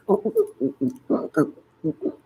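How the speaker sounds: loud rising ooh cries; loud ooh sounds. Shown as a demonstration. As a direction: belt out the oohs. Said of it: "when my voice goes".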